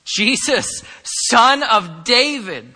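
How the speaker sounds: loud, high acted cry, drawn out. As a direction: call out to someone passing by. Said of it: "At the top of his lungs, cried out".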